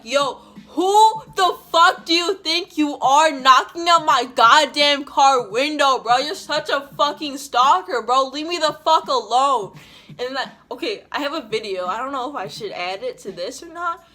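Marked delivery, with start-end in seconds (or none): 0.0-8.3 furious yelling, much louder and higher; full-voiced angry yell at a stranger; screaming. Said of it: "I started screaming". 8.3-9.8 furious yell, much louder and higher; yelling at the stranger to leave; screaming. Retold or imitated. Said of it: "I started screaming".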